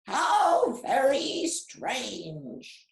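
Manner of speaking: slow, sneering parrot voice; mocking parrot. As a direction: drag it out, mocking. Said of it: "squawked the parrot".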